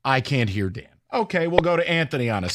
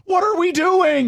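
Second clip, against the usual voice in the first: exasperated; 12 semitones higher, 4 dB louder than usual. Real, not acted. Host venting over holdout.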